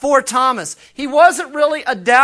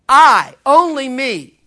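slow, louder, pressing "I" and "only me"; emphatic only me; 2 semitones higher, 7 dB louder, slower than usual. Shown as a demonstration. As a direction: hammer home "only me", slowly and louder. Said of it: "but only I".

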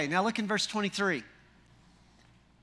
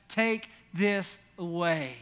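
slow, lower, measured order; stern biblical command; 4 semitones lower, slower than usual. Acted. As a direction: spell out the order word by word, low. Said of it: "he said to those who sold the doves".

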